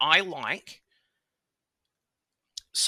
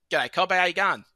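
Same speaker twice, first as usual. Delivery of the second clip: higher, louder over-the-top Aussie greeting; hammed-up Australian; 6 semitones higher, 6 dB louder than usual. Greeting foreigners in a hammed-up broad Australian accent. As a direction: ham up the Australian for the crowd.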